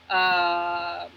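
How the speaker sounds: usual speaking voice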